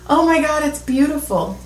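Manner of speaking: retold awe. Friend on mushrooms marvels down the phone, mock awestruck.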